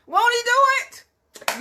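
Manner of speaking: high, loud and slow catchphrase; imitating Paul Martin. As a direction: stretch it out like the radio host. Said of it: "I can't say it like Paul Martin say".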